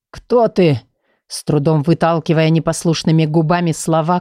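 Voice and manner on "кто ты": calling out. A narrator's reading. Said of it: "крикнул в наступившую тишину".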